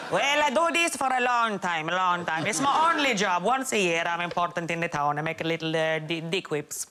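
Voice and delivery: put-on hammy old Italian man accent; lightly put-on Italian accent. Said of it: "That was my Italian accent".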